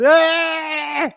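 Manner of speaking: high, loud yelp mocking the dancer; mock jig yelp. Mocking a TV jig dancer to a friend, a hammed-up high yelp. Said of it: "and it goes".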